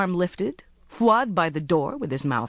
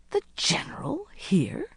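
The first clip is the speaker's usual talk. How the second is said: high, hushed disbelief; incredulous; 7 semitones higher, 3 dB quieter than usual. A narrator's reading. Captive recognising a rescuer's voice, incredulous. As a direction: think it aloud, astonished. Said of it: "She thought incredulously".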